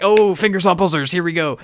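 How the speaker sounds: put-on American accent masking panic. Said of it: "Clicks back into the American accent".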